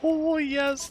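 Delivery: very high, gleeful laughing cheer. Real, not acted. Player finally using up ferrite dust, gleeful laugh. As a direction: squeal with relief and delight.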